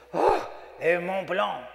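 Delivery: drawn-out, awed "Oh, a Mont Blanc"; admiring yuppie. Retold or imitated. Yuppie buddy spotting the pen on my chest, drawn-out awe.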